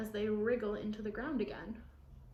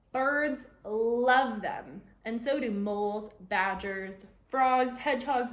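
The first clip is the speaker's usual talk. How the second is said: emphatic list; 8 dB louder, slower than usual. Acted. Grandma listing worm predators.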